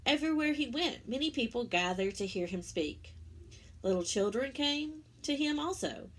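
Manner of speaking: gentle storybook narration; reading to children. Acted. Teacher reading the Easter story to children, storybook voice. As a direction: tell it warmly to little ones.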